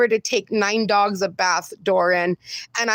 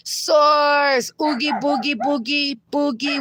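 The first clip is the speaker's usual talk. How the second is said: playful sing-song greeting; 4 semitones higher than usual. Real, not acted. Host teasing viewer Source with a silly singsong greeting. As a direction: toss out a goofy singsong nickname.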